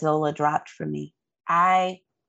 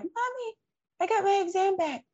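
put-on much higher little-girl voice, quick and breathless; imitating crying daughter; 4 dB quieter, faster than usual. Mother voicing her daughter running over with the exam, high and childlike. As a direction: play the daughter running up in tears. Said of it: "and she's like".

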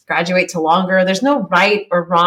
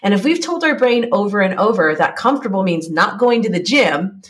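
ordinary talk, nothing marked

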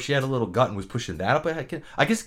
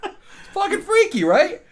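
laughing; 6 dB louder, slower than usual. Real, not acted. Laughing at her own story.

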